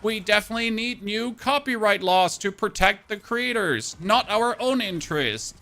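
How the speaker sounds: high, loud, hammed-up alarm, slowing down; mocking fake concern; mock media panic. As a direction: ham up a panicked news anchor. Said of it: "the traditional media being like".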